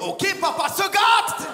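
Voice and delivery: high, slow, drawn-out gloat; hammed-up creepy delight; sleazy gloating. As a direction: savour it like a creep treating himself. Imagined creep at home.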